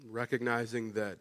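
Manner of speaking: soft, low, unhurried prayer voice. Acted. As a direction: lead the congregation gently in prayer. Leads a prayer.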